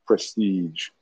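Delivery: ordinary delivery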